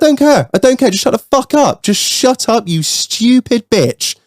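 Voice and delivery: high-pitched, dismissive retold outburst; heated retort, dragging out the final insult; acted-out furious retort. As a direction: re-enact cutting someone off in a row. Retold row, his retort.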